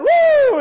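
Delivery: loud, high sustained whoop; high whooping "woo". Retold or imitated. Preacher imitates last night's excited congregation, whooping. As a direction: let out a revival-meeting whoop. Imitates shouting at church service.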